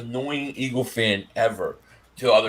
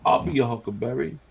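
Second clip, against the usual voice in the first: imitated Southern accent, pitched lower; Doc Holliday; 6 semitones lower than usual. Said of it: "like Doc Holliday".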